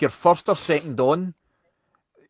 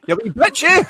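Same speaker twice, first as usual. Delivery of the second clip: mock customer; 13 semitones higher, 6 dB louder than usual. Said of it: "the next customer's gonna go".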